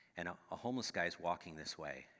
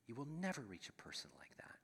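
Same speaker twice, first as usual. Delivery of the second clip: whispered; 2 semitones higher, 8 dB quieter than usual. Shown as a demonstration. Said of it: "repeated line, second whispered".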